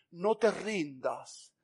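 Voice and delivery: very slow, drawn-out encouragement; solemn reassurance. Acted. Preacher passing on God's morning word, solemn reassurance. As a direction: stretch each word out earnestly.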